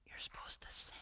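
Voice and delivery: hushed church whisper, unvoiced. Churchgoer nudging newcomer during song, whispered. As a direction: lean in and whisper a reminder. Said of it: "and goes".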